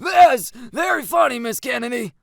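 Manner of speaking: stern coach's put-down; exasperated coach. A narrator's reading. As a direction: scold a student with weary sarcasm. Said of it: "The coach sighs".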